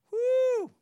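high loud whoo; a hyped preacher's holler; excited whoop. Real, not acted. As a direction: let out a big whoop. Preacher reacts to harsh verse.

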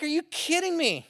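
high, loud mock disbelief; exasperated, hammed-up protest; ticked off. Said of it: "the disciples are ticked".

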